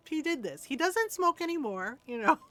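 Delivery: normal talking voice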